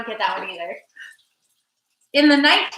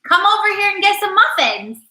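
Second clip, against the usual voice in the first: higher-pitched call to a partner; lilting, raised call; 8 semitones higher, 4 dB louder than usual. Real, not acted. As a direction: call them over to you, pitched up. Calls honey over for muffins.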